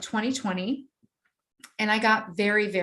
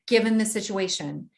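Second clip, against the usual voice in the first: normal talking voice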